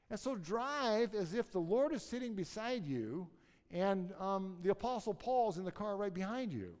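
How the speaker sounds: rising, impassioned preacher voice, pitched well up; fervent preaching. Acted. Pastor preaching on confident living.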